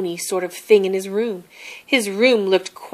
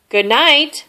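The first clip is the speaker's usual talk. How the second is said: called out, higher and louder; 7 semitones higher, 6 dB louder than usual. A narrator's reading. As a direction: call across the room. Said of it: "Mouse called a little louder".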